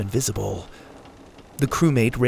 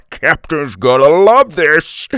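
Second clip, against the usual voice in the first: laughs, then talks through a gleeful chuckle; chuckling; 4 semitones higher, 8 dB louder, slower than usual. A narrator's reading. Bald man chuckling over the prize camera, laughing. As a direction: let the chuckle spill into the words. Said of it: "The bald man chuckled".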